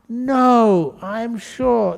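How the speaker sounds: mock stubborn insisting; lightly hammed-up wife digging in; insistent wife.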